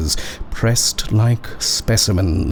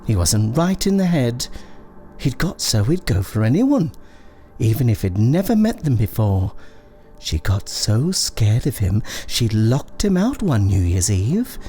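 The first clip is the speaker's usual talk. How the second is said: high-pitched, quick character voice with swooping tune; gossiping neighbour; 6 semitones higher than usual. A narrator's reading. Neighbour telling Dorothy about the son next door, high gossipy voice. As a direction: tell it as eager gossip, pitched well up.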